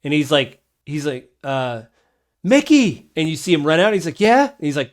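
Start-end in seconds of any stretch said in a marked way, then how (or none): 2.4-3.1 high, loud summoning call. Acted. Stable keeper calls his young helper out from the stables, calling out. 4.2-4.5 put-on high, loud child's reply; child kender. Child kender answers the stable keeper's call, high kid voice. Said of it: "this is a child Kender".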